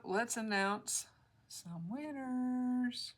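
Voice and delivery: slow, drawn-out "some winners" like a game-show host; mock announcer. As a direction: stretch it out to build suspense.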